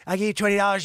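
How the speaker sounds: high, loud hard-nosed offer; bullying lowballer. Acted. Buyer bullies man paying his bills, loud lowball.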